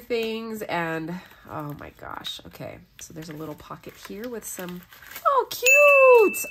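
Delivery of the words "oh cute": 1 squeal. Real, not acted. Finding a pocket gift.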